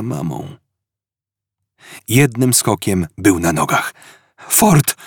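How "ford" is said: sharp high call across the room. Acted. Arthur calls out to Ford, urgent high call. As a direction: call his friend's name urgently.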